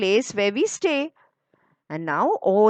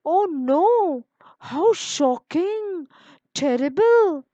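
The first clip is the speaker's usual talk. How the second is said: higher, drawn-out gasp of dismay; two kids hearing bad news; shocked children; 9 semitones higher, much slower than usual.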